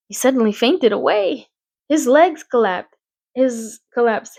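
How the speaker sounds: plain reading voice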